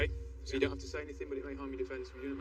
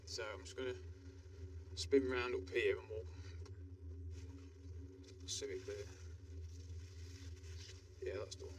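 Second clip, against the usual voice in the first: hushed; 3 semitones lower, 10 dB quieter than usual. Real, not acted. Watching suspect covertly from car.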